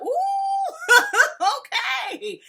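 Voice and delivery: high squeal into laughter; impressed laugh; laughing ooh. Retold or imitated. Vlogger reacting to Mariah's threat, laughing. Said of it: "I was like".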